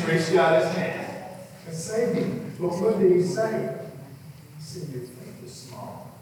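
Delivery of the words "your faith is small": much softer, breathy near-whisper, slower; soft rebuke. Acted. Jesus quietly rebuking Peter after saving him, soft whisper. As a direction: hush it down like a gentle correction.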